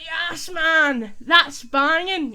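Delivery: loud, high, drawn-out celebration; elated fan shout, stretching BANGING; football goal cheer. Acted. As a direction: roar it like your team just scored. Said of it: "your team scores".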